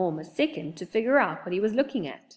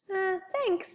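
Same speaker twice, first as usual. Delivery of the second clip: much higher, small squeaky voice; nervous squeak; 13 semitones higher than usual. Acted. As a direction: squeak it out timidly. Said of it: "squeaked Oliver".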